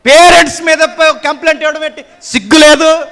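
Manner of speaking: loud, high rebuke; a preacher shouts at children who file cases against their parents; scolding outburst. Acted. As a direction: shame them with a sharp cry.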